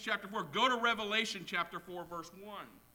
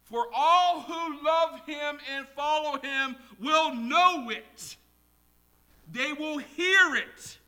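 loud, high, emphatic sermon delivery; preaching at full force; 8 semitones higher, 9 dB louder, slower than usual. Real, not acted. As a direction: drive each line home to the congregation, slowly on key words.

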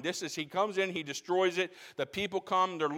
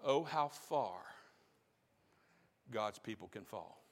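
mournful hush; 8 semitones lower, 10 dB quieter than usual. Real, not acted. Preacher lamenting to the church, soft and sorrowful. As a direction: drop to a soft, sorrowful near-whisper.

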